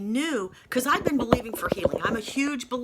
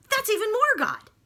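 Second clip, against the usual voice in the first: excited realisation; 12 semitones higher, 5 dB louder than usual. Retold or imitated. Sudden joyful realisation.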